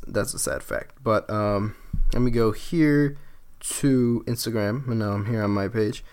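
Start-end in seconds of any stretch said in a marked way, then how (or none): 0.1-1.0 muttered under the breath; breathy whisper, a sad aside. Real, not acted. Sad aside about idiots.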